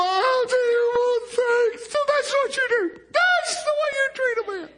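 high, thin put-on whine; mock indignant complaint. Acting what Moses didn't say to God, mock indignant complaint. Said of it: "But Moses didn't go".

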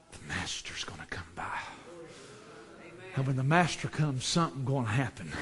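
low, soft, confiding delivery; hushed preaching. Real, not acted. Preacher promising the master will come by, low hush. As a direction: drop right down, nearly to a whisper, to build suspense.

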